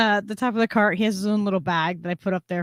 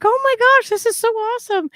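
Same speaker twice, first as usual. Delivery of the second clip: much higher, imitated squeal of delight; freaking out; 12 semitones higher than usual. Retelling the excited lady at the store, high imitated squeal. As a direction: squeal it like a thrilled stranger. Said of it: "So she's freaking out".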